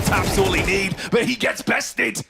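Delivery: high, tense clutch call; excited. Real, not acted. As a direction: build the tension to the last duel. Clutch moment being called.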